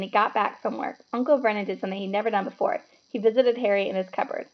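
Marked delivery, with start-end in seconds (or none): none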